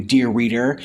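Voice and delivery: ordinary delivery, nothing marked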